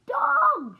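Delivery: loud, very high croaking squawk; long, piercing bird cry; parrot croak. A narrator's reading. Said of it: "she croaked".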